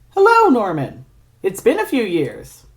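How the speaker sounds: mock friendly greeting. Greeting an enemy with a smile.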